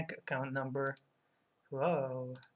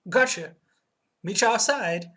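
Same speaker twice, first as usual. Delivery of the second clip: bad New York accent; 4 semitones higher, 8 dB louder than usual. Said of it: "I'm doing a terrible accent".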